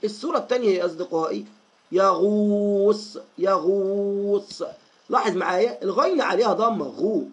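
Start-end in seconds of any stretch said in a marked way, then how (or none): 1.9-4.6 يغوص very slow with a long held oo; slow teaching model of يغوص, vowel held steady; lengthened damma. Shown as a demonstration. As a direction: stretch the oo in يغوص. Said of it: "هنطول في الضمة".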